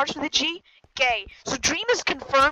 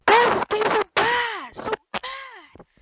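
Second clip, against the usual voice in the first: This game's so bad! louder and slow, stretched out; louder whine; 5 semitones higher, 6 dB louder, slower than usual. Whining about the game.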